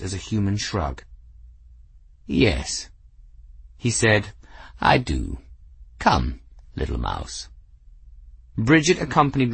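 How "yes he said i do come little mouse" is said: low, lofty cat voice; proud cat. Acted. Cat answering grateful Bridget, low and lofty. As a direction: speak as a cat who knows he's perfect.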